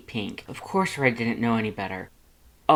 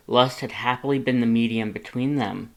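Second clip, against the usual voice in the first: plain reading voice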